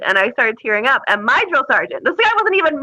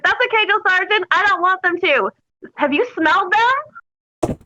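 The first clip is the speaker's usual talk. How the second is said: higher-pitched, sweetly cheerful cheek; super sunny; 6 semitones higher, slower than usual. Retold or imitated. Recruit answers her drill sergeant back, super sunny. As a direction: answer with the biggest smile. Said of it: "My biggest smile, super sunny".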